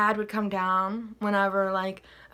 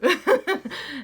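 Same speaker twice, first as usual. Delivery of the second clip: amused high laugh; 7 semitones higher, 5 dB louder than usual. Real, not acted. Laughing at own clumsy dancing.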